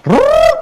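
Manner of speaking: high, loud rolled rrrr noise; a quick spinning rrrr, like languages switching; zabawny warkot. Retold or imitated.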